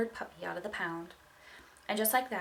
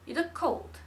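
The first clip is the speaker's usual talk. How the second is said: put-on French accent; 7 semitones higher, 4 dB louder, slower than usual. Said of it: "in a thick French accent".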